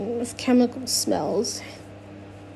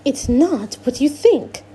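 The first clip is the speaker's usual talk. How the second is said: insistent Miss Teak; 3 semitones higher than usual. Acted. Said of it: "Miss Teak repeats".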